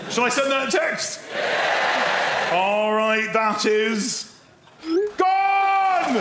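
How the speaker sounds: high announcement ending in a long loud cry; showman's call-out. Real, not acted. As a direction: whip up the crowd, then explode. Showman announces sending text to crowd.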